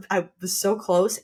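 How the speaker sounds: ordinary talk, nothing marked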